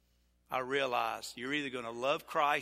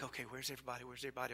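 much softer, muttering to himself; muttered worry; 12 dB quieter, much faster than usual. Retold or imitated. Pastor counting heads on the trip, muttering anxiously. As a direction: mutter it while scanning the crowd. Pastor retells searching for lost group.

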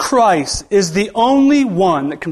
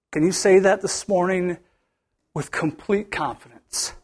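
usual speaking voice

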